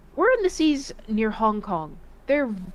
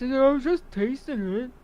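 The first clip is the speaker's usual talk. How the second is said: dopey coworker voice; 2 semitones higher than usual. Retold or imitated. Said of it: "And that's how he talked too".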